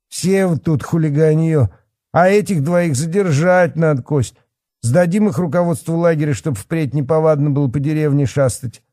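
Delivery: high-pitched irate official; blustering policeman. Acted. Policeman orders the kids detained, blustering. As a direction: rant with bullying indignation.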